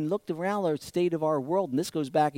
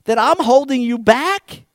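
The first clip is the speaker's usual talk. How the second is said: loud, high, incredulous questioning; God's mocking astonishment, pitched right up; mocking disbelief; 12 semitones higher, 9 dB louder than usual. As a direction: scoff at a ridiculous accusation. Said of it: "He's laughing".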